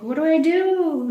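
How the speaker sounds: high, loud plea for help; acted-out fretting to a husband; retold panic.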